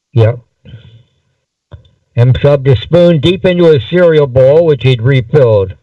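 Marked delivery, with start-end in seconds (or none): none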